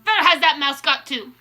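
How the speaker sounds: imperious, cross witch voice; snapped demand. A narrator's reading. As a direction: demand it sharply while searching. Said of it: "snapped the Grand High Witch".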